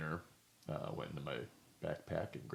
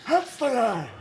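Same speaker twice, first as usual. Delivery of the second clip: high, loud Doc Brown shout; 13 dB louder, slower than usual. Retold or imitated. Repeating the store staff line as Doc Brown, loud. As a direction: point and yell like the mad scientist. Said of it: "went into my Doc Brown, uh, Christopher Lloyd".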